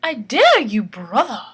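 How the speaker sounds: loud defiant challenge. Acted. Aretha defying her brother, loud and defiant. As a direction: throw the dare back at him. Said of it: "Aretha saying".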